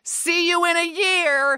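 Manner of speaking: high, drawn-out mock scream; screamed farewell. Audience member to performers leaving the stage, screamed out. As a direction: call it out slowly, high-pitched. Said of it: "the guy screamed out".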